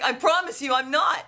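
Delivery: high-pitched, emphatic mock retort; retold insistent protest. Patient contradicting the dentist about being numb, high insistent retort.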